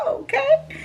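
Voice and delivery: high giggly laugh into an okay; giggling okay. Real, not acted. Giddy giggle hearing his French accent.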